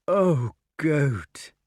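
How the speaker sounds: mildly broken-hearted plea. A narrator's reading. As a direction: lament with a falling cry. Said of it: "said the wart in a broken voice".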